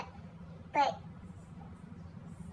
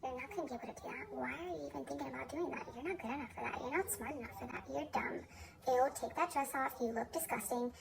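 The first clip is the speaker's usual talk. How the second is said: inner critic voice; 10 dB quieter, faster than usual. Retold or imitated. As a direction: rattle off the cruel inner voice, hushed. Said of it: "that negative little voice in my head".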